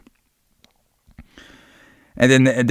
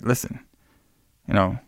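soft, halting imitation of a creep; creepy; 2 semitones lower, 4 dB quieter than usual. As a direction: play the creep, softly with unsettling pauses. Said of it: "Ooh, what a creep".

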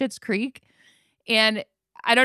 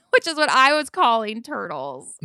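normal talking voice

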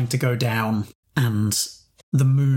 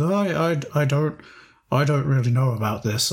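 hesitant Boris voice; 2 semitones higher than usual. Acted. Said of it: "I can't do voices".

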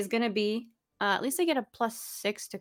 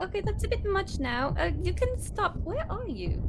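high, nervous pleading; uneasy; 10 semitones higher than usual. Acted. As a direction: ask it to stop, spooked. Uneasy at creepy hands.

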